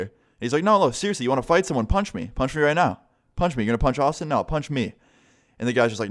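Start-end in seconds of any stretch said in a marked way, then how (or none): 0.6-4.9 high-pitched, insistent dare; imitated goading. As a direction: egg him on as the teacher in the story. Teacher dares the bully to punch.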